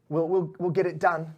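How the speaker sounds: a touch of nervous underling; placating henchmen. Acted. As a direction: stumble over promises to the boss. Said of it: "everyone's like".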